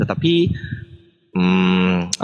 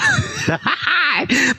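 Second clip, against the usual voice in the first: high burst of laughter; 12 semitones higher than usual. Real, not acted. Confused by dictionary.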